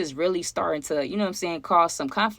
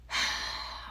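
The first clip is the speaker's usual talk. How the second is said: long soft exasperated sigh; a quiet fed-up exhale; weary sigh; 10 dB quieter than usual. Retold or imitated. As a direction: let out a tired sigh before speaking. Retold annoyance at dinner table.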